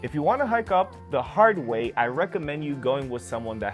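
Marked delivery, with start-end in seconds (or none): none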